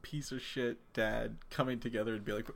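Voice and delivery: ordinary talk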